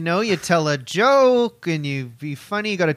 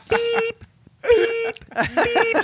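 high beeping like a truck backing up; beep, beep, high and mechanical; reversing truck beeps; much slower than usual. Pretend. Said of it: "Just a truck backing up sound".

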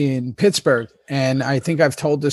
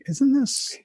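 amused disbelief; 3 semitones higher than usual. Retold or imitated. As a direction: play baffled, with a laugh creeping in. Retelling baffled reaction to missing accent.

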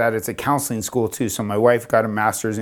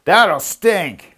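hammed-up skit guard, higher pitched; raised, theatrical soldier voice; Roman soldier line; 7 semitones higher, 4 dB louder than usual. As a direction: deliver it like a Roman guard in a church play. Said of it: "like in a Roman soldier".

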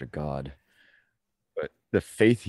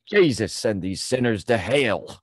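preacher voice; 5 semitones higher, 5 dB louder, slower than usual. Retold or imitated. Mock prayer.

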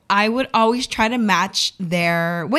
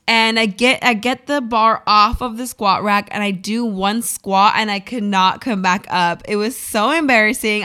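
ordinary talk, nothing marked

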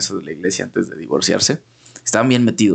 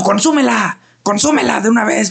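angry yell; 11 semitones higher than usual. Retold or imitated. Church helper yelling at the boy to eat the host, angry yell. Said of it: "sí me empezó a gritar".

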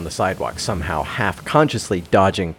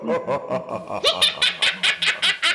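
high, falling hammed-up cackle; mock manic laugh; 3 dB quieter than usual. Narrator ruined for ordinary coffee, hammed-up manic laugh. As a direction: laugh like the coffee drove you mad. Manic realization ends story.

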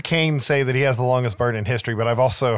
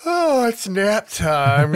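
higher, slow, singsong mock nap voice; mock sleepy; 7 semitones higher, much slower than usual. Mocking the heroine after all that coffee, slow mock sleepy. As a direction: drawl it out like the idea is silly. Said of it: "and you're like".

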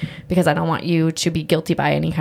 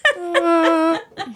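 high laugh; 10 semitones higher than usual. Real, not acted. Laughs after joking about adoption.

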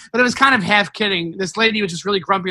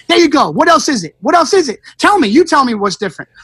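heated, raised-pitch demand; freaking out; 5 semitones higher, 4 dB louder than usual. Retold or imitated. Comic confronts a drunk heckler, freaking out. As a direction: fire off challenges like you've lost patience. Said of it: "And I freaked out".

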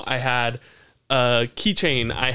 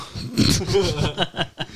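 laugh; 4 semitones higher than usual. Real, not acted. Laugh after the shout.

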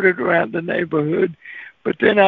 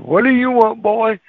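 gruff homeowner; 4 semitones higher than usual. Retold or imitated. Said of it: "This voice said".

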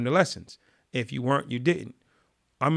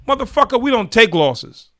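angry scolding; 5 dB louder than usual. Retold or imitated. Gang leader chewing out a member at the lunch table, loud fury. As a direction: lay down the law, furious.

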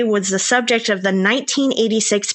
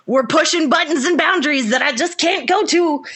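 ordinary talk, nothing marked

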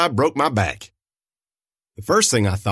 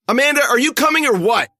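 high, loud impatient call; 12 semitones higher, 4 dB louder than usual. A narrator's reading. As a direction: hurry her up from a distance. Frankie calling from the truck.

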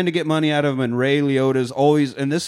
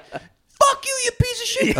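very high, strained mock scream. Spouse screaming in a joked-about courtship, high mock scream. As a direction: scream it like a comic lovers' fight. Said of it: "the screaming part of my courtship".

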